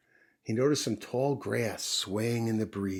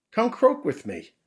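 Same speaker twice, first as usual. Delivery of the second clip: 1 cheerful frog; 11 semitones higher, 9 dB louder than usual. Acted. Frog invites mouse.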